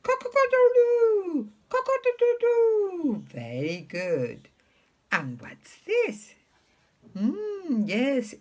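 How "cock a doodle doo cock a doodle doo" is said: very high crowing with long last note; high-pitched cock-a-doodle call, tail held; rooster crow. Retold or imitated. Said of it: "what does the rooster say".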